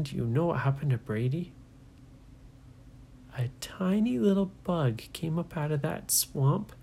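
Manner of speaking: ordinary delivery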